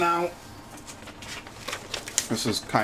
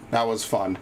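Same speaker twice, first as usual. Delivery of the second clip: flat, lowered sarcasm; dry sarcastic praise for a dull run; sarcastic deadpan; 4 semitones lower, 9 dB quieter than usual. Real, not acted. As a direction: say it so nobody believes you.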